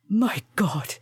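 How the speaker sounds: ordinary delivery, nothing marked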